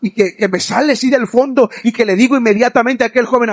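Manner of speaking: ordinary delivery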